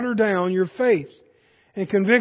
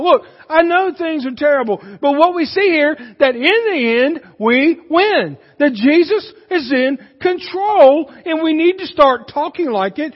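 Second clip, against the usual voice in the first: impassioned, raised sermon voice; forceful preaching; 4 semitones higher, 6 dB louder than usual. Real, not acted. Preacher urging the church to believe, forceful preaching. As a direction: drive each point home to the congregation.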